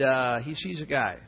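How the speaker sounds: ordinary delivery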